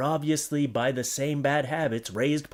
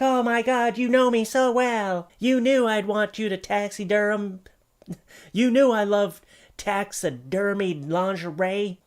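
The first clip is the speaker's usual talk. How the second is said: higher, gushing delighted wife; mock wife; 8 semitones higher, 3 dB louder than usual. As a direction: gush like a wife thrilled with the gift. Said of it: "Your wife was like".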